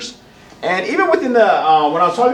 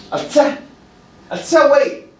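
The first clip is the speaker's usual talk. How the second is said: stern, raised, higher-pitched command; stern Navajo tone; 8 semitones higher, 4 dB louder than usual. Shown as a demonstration. Showing how stern Navajo sounds to toddlers, stern and raised. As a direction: give a sharp, stern order to wait. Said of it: "It's more stern".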